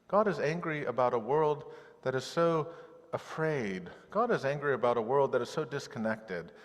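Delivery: ordinary talk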